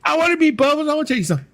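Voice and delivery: very high, eager imitation; mock excited plea. Comic begging to meet Bubbles, high mock excitement. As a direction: beg eagerly in a squeaky mock voice. Retelling Eddie Murphy's eager request.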